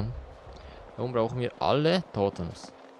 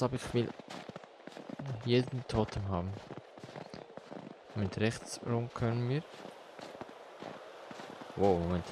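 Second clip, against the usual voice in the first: usual speaking voice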